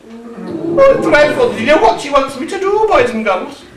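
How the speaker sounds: ordinary talk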